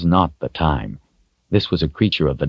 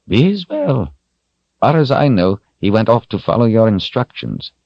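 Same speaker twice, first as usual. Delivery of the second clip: higher, lightly aged wizard voice, matter-of-fact; an old wizard reporting calmly; old wizard Zed; 6 semitones higher than usual. A narrator's reading. Zed tells Richard his friend is fine, calm old wizard voice.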